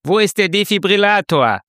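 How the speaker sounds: high, loud urgent call; desperate shout for the defibrillator. Acted. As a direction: yell it into the room.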